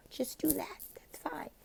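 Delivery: high whispered just do that; whispered aside. Real, not acted. Teacher whispering a quick aside. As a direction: whisper it casually. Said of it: "whispered aside".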